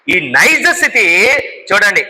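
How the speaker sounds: normal talking voice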